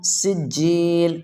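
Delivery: "سجیل" very slow, with a whistled S; whistling S. Shown as a demonstration. Teacher shows students the letter seen, whistling S in slow "سجیل". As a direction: let the S in "سجیل" whistle, then stretch the ending. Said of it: "سین سے سیٹی کی آواز آنی چاہیے".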